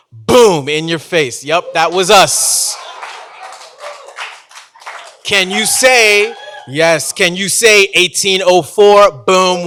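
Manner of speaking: ordinary delivery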